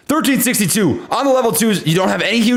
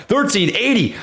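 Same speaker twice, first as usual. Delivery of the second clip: ordinary delivery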